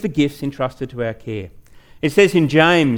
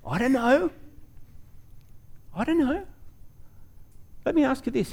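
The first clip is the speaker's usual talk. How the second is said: mock clueless reply; 9 semitones higher, 9 dB quieter than usual. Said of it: "people who would just go".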